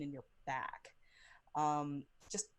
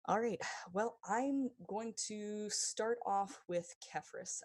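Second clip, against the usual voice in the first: normal talking voice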